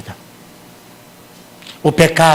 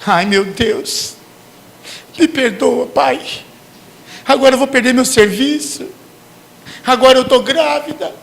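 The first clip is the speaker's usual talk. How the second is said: higher, pleading panicked prayer; mock desperation; 7 semitones higher than usual. Sinner facing consequences, hammed-up desperate pleading. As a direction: beg God in mock panic. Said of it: "você se desespera".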